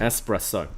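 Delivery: "espresso" as es-presso, low and soft; correct pronunciation. Shown as a demonstration. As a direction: echo "espresso" with an S and no X. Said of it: "Is there an X in it?".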